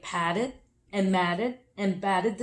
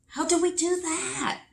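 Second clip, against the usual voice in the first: higher character voice; higher, asking eagerly; animal voices; 9 semitones higher than usual. Acted. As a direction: voice the curious animals. Animals ask.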